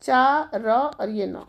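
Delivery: चा र and न said apart, very slowly; चारण को धीरे धीरे अक्षर अक्षर बोलना; syllable by syllable. Shown as a demonstration. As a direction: break चारण into its letters slowly. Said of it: "चारण".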